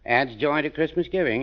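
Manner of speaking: a touch of mock ad pitch on the slogan; reading the box slogan. Druggist reading the box slogan to Gildersleeve, mock ad tone. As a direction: read the box copy like a little sales line. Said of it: "it says on the box".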